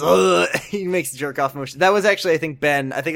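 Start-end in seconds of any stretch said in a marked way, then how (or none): 0.0-0.4 loud mock ugh of disgust; imitated disgusted groan. Cohost echoing Ben's rude groan, loud mock ugh. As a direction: groan it like a jerk. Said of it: "Yeah, he goes".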